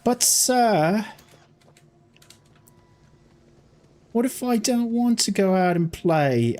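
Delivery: high, slow British schoolboy whine; mock the annoying British kids at school with a whiny British accent; imitating a whiny British schoolkid accent. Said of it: "The ones that spoke with the".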